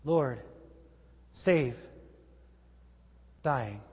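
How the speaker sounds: soft, low, defeated prayer; hushed cry for help, sinking at the end; overwhelmed plea. Acted. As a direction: drop each word quietly, with long pauses. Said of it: "My life is overwhelming".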